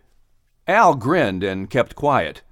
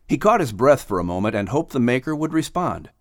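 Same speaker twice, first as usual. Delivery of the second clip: usual speaking voice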